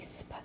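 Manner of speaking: "sp." as one short whispered-soft blend; blend sound sp. Shown as a demonstration. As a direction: sound out "sp." very softly, both consonants together. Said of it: "I do see the blend right here, S P".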